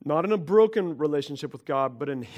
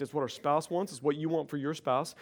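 usual speaking voice

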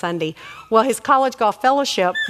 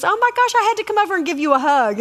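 high, excited quoted gush; 9 semitones higher, faster than usual. Retold or imitated. Friend running across the room to hug her, excited. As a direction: gush it in a higher voice.

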